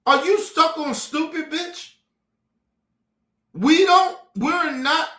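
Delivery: rising, exasperated clapback; incredulous, rising rant; heated retort. Real, not acted. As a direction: snap back at them, fed up. Angry retort to those people.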